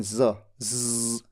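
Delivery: ज said softly as a buzzing z, low; dotted z sound. Shown as a demonstration. Teacher demonstrates the dotted ज sound. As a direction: buzz the ज like an English z. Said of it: "जिसमें नीचे बिंदु होता है".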